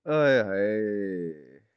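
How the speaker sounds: slow drawn-out wail; dramatic aye haye. Real, not acted. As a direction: stretch it out like a lament. Mock lament on losing.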